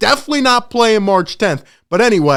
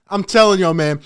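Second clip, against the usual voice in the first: usual speaking voice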